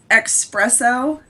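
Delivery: "espresso" said as "expresso"; mispronounced with X. Retold or imitated. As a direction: put an X into "espresso". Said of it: "there's not even an X".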